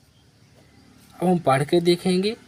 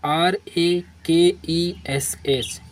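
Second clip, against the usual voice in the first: letter by letter, spaced out; dictating letters to a class; slow spelling; 2 semitones higher, much slower than usual. Shown as a demonstration. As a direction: spell each letter slowly. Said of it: "spelling the letters out".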